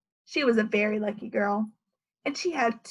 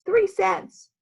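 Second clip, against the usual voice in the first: indignant three cents; 6 semitones higher, 4 dB louder than usual. Acted. Leslie furious.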